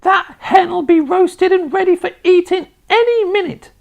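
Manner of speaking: put-on very high, loud old-woman screech; piercing sustained holler; Grandma shouting. A narrator's reading. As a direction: shout it shrilly down from the rooftop. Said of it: "Grandma shouted".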